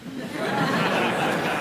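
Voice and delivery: airy high laugh; soft laugh at own pun; breathy laugh. Real, not acted.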